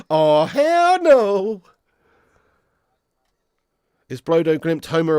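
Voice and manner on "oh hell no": very slow, high and loud hell no; emphatic refusal. Real, not acted. Streamer asked to do the draw, loud drawn-out refusal. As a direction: drag out the refusal, louder.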